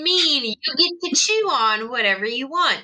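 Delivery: sing-song telling-off, jumping high on the question; exaggerated pet-owner lecture; mock scolding. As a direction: lecture naughty pets with swooping pitch. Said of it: "I go".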